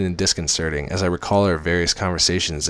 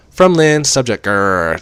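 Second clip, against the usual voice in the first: mock angry; 5 semitones higher, 9 dB louder, slower than usual. Lynn's angry email.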